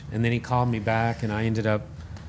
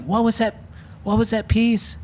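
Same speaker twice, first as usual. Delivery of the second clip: curious rock star; 10 semitones higher than usual. Retold or imitated. [name] quoted asking.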